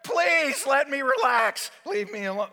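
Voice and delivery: loud, high, drawn-out begging; acted exasperated patient; desperate plea. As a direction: cry out slowly for some peace.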